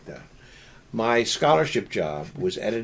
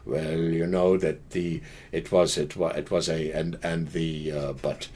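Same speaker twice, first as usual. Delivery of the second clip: halting interviewee imitation; 7 semitones lower, 4 dB quieter than usual. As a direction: mimic someone fumbling through fillers and false starts. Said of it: "like ers and uhs and buts and pauses".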